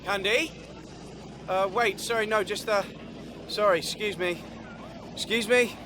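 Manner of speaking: high-pitched, distant call through a crowd; tentative calling out. Acted. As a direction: push through politely, calling softly and high. Calling after someone through crowd.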